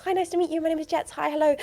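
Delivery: prim nervous English introduction; high posh British accent. Retold or imitated. Anxious student introducing herself in posh British voice. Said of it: "I'd be like".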